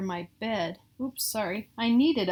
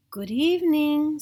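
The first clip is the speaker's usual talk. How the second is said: low breathy monster; 6 semitones higher, 6 dB louder than usual. Acted. Substitute monster Herbert greets the child, breathy. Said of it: "said a low, breathy, breathy voice".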